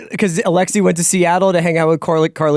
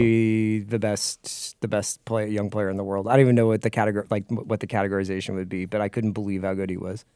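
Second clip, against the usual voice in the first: hushed awe; 7 semitones lower, 9 dB quieter than usual. Real, not acted. Awed praise of Olise.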